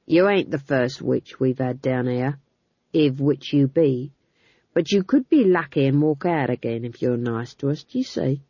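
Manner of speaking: a thug being falsely friendly; menacing guard. Acted. Guard pins Magrat to the wall, low and leering.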